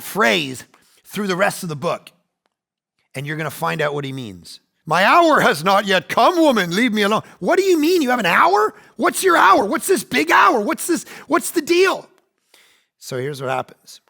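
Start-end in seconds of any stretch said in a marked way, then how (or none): 4.9-7.2 mock brush-off. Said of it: "Jesus brushing his mother off". 7.4-12.1 much higher, loud baffled demands; exasperated shout. Pretend. Confused reader shouting questions at a cryptic Jesus, loud and high. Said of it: "you're like".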